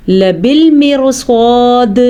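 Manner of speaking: لبالمرصاد with a full, heavy ra before the saad; لبالمرصاد read with a heavy ra, drawn out. Shown as a demonstration. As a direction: say لبالمرصاد slowly, ra thick and rounded. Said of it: "صاد پر پڑھیں گے".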